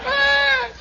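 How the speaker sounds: loud high-pitched bawl; hammed-up shrieking cry; mock crying wail. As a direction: burst into put-on sobbing. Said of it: "He's crying".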